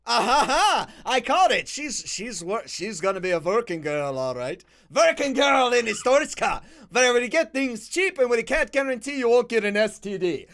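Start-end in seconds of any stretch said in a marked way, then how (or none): 0.1-0.9 loud, gloating "ah ha ha"; caught-you cackle; triumphant cackle. Real, not acted. Gloats at catching traveler. 4.9-10.5 put-on loud Slavic-accented sales pitch; higher, louder put-on Slavic voice; mock Eastern European accent. Mock announcement as inspector.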